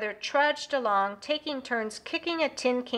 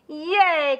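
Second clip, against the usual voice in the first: a louder, high cheer of delight; excited cheer; 5 semitones higher, 6 dB louder than usual. Acted. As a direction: cheer for the new skate park. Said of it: "cried Sister and Brother".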